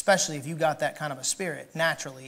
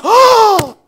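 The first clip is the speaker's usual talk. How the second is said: shrill gasping outrage, higher and louder, slow; mock shocked gossip; 13 semitones higher, 14 dB louder, much slower than usual. Church crowd gasps at the victim's story, hammed-up shouting. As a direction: ham up the busybodies' shock. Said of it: "Everybody goes".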